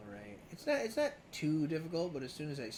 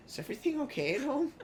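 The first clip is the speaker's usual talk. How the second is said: concerned voice; 12 semitones higher, 4 dB louder, faster than usual. Retold or imitated. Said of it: "It's like, dude".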